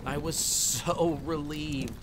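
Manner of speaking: mildly acted, lingering relief; drawn-out relief. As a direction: draw out the relief, slowly. Narrator recalls relief at rescue.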